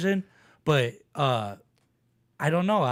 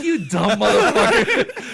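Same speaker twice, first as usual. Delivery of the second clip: laughing insult; 6 semitones higher, 8 dB louder than usual. Retold or imitated. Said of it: "He was like".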